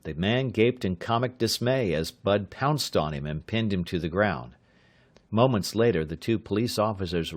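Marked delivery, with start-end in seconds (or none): none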